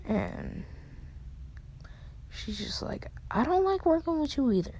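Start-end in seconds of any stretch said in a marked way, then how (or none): 3.3-4.7 high, rushed comeback; higher, fast annoyed protest; indignant retort. Retold or imitated. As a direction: fire it back quickly, pitched up. Retort in acted quarrel.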